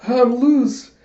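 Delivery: high, loud burst of mock relief; acted elated exclamation. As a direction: exclaim as if suddenly set free. Freed character exclaims.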